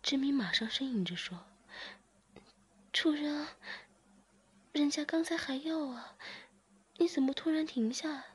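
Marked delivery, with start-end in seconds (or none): 2.9-8.2 lifted, pleading character voice; higher character voice. Acted. Zhiming asks master why he stopped, pleading. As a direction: voice her higher, coaxing and needy.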